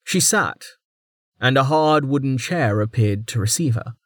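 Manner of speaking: normal talking voice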